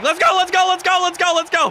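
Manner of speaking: high, loud hyped yelling; excited shout. Retold or imitated. Friend hears his hero did Havoc forty, shouts let's go. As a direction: yell it like a starstruck fan. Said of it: "he was like".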